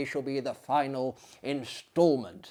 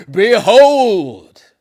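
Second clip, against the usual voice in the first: much higher and louder, a ringing cry; booming behold; 8 semitones higher, 18 dB louder than usual. Acted. Fiend commanding the sleeper to look, in a booming cry. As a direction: throw it out like a ghost unveiling the graves.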